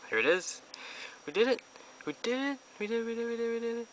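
high, loud joyful squealing; shrieking jackpot winner. Real, not acted. Celebrating the pull.

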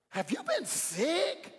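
very slow, high, put-on concerned voice; mock worried friend. Someone asks the too-thin referee if he's been sick, slow high mock worry. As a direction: stretch it out like shocked concern.

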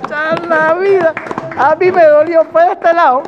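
loud, high, exaggerated wincing commentary; hammed-up pained disbelief; mock hurt. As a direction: wail as if the blow landed on you. Comic pained reaction to clip.

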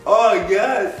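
ordinary talk

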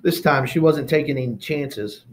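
ordinary delivery, nothing marked

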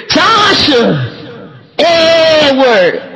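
high, drawn-out name calls; Grandma calling names. Retold or imitated. Grandma calling the grandkids, drawn-out high holler. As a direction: call each name out long and high like Grandma. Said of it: "she said them wrong".

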